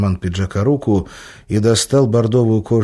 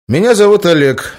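put-on higher, louder formal young man; guest introducing himself; 10 semitones higher, 8 dB louder than usual. A narrator's reading. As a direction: stiff bodyguard introducing himself. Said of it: "представился гость".

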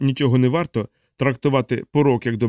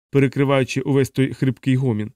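usual speaking voice

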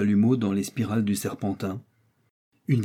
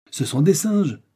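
higher, excited outcry; indignant exclamation; 6 semitones higher, 4 dB louder than usual. A narrator's reading. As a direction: exclaim it in astonishment. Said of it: "s'écria Joe".

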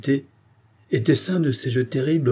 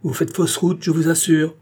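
high-pitched, insistent royal rebuke; haughty warning, pitched up; imperious interruption; 8 semitones higher than usual. A narrator's reading. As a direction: cut him short in a raised, commanding voice. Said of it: "l'interrompant avec un geste impérieux".

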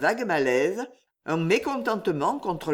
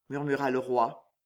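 ordinary delivery

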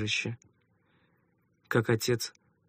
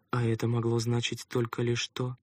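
usual speaking voice

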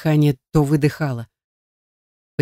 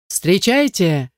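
slow, drawn-out ring announcer call; booming announcer; 4 semitones higher, much slower than usual. A narrator's reading. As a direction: boom it out to the whole club. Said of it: "прогромыхал конферансье на весь клуб".

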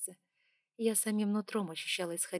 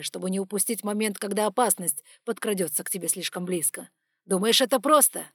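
loud, forceful complaint; angry vent; 9 dB louder than usual. Acted. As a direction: hammer home his burden. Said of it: "dragon vents his strain".

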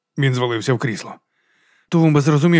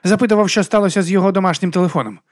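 higher-pitched metallic phone voice; 7 semitones higher than usual. A narrator's reading. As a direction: answer like a metallic voice on the line. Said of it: "відповів металевий голос".

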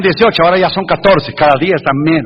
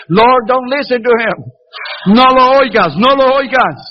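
high, pleading mock prayer to the Lord; 6 semitones higher than usual. Preacher jokingly begging the Lord to ignore the passenger, high and pleading. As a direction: beg God, half joking, to ignore him.

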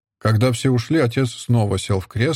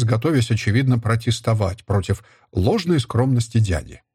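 ordinary delivery, nothing marked

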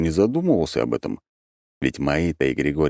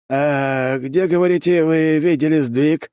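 high, raised, would-be offhand question; feigned casualness; 11 semitones higher, 4 dB louder, slower than usual. A narrator's reading. Архимаг asks about the shift, pretending casualness. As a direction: ask as if by the way, pitch up. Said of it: "как бы невзначай спросил Архимаг".

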